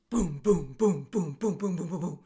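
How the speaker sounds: percussive booms tumbling into each other; imitated falling boulders. Storyteller imitates boulders blocking the path, booming sound effect. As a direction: mimic boulders crashing down, boom after boom. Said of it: "giant boulders come falling from the sky".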